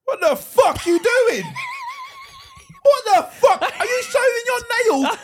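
a hammed-up outraged yell at a stranger; furious shout. Yelling at the woman filing toenails on the train, outraged shout. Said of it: "That's what I would say".

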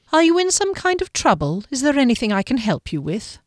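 lightly voiced guardian, starting high; concerned guardian. Guardian offering help to the troubled woman, concerned.

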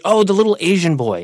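imitating the customer, high and loud; oblivious self-correction. Husband obeying his wife's correction, obliviously.

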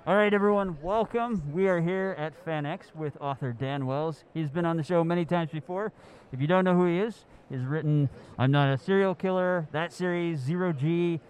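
muffled behind a mask; talking through a face mask. Real, not acted. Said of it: "they might sound a little bit muffled".

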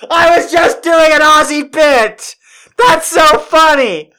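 can't believe it's true; shocked disbelief. Real, not acted. Shocked at the real pronunciation.

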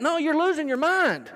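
high, emphatic contradiction; teasing, high-pitched comeback; playful rebuttal. Real, not acted. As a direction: pitch it up and correct them. Preacher rebuts the imagined shopper.

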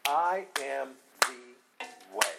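"I am the way" slow, word by word; very emphatic. Shown as a demonstration. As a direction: spell out "I am the way" with weighty pauses. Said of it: "Jesus is being very emphatic here".

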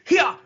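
short, high, loud kiai cries; sharp high-pitched karate shouts in imitation; mock karate yells. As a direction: yelp like a martial artist striking. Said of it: "Karate noises? Really?".

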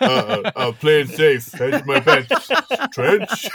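loud, unsure mock Stallone; hesitant Stallone. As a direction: hedge every word, then ask. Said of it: "So he's like".

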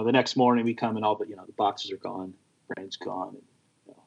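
normal talking voice

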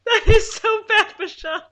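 loud laughing groan; cracking up at a bad pun. Real, not acted.

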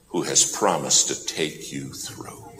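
ordinary talk, nothing marked

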